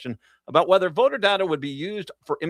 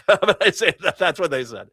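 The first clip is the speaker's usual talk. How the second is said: laughing; 4 semitones higher, 5 dB louder than usual. Real, not acted.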